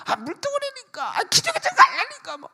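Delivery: high-pitched mock irritable patient; dying patient's anger. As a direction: mimic a sick patient snapping. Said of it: "엄청나게 화를 내더라고요".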